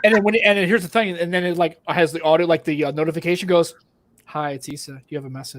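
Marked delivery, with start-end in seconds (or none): 4.2-5.6 soft, low, seductive phone voice. Retold or imitated. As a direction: purr the notification softly. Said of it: "Why do you sound like a, ... like a seductive?".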